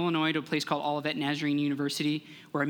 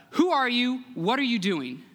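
higher-pitched challenge called out; calling out; 7 semitones higher than usual. Acted. Roman guard to the rabbi from the looming darkness, calling out. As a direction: call out a challenge from the dark. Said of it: "a voice shouting out from the looming darkness".

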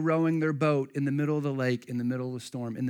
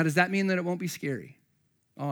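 usual speaking voice

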